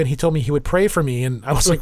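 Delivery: ordinary talk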